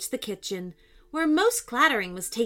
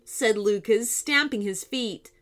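usual speaking voice

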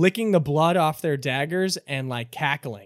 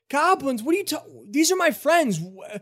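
flustered Richie; 8 semitones higher than usual. Acted. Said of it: "Richie goes".

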